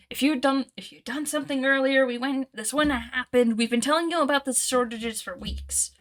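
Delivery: loud, high furious rant at the guards; angry goblin berating. Acted.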